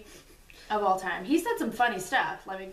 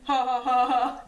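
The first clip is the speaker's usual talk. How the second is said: louder, hammed-up ha ha ha; mock laugh; 3 semitones higher, 8 dB louder than usual. Husband glad his wife ignored him, mock laughing. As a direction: fake a smug laugh. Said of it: "And he's like".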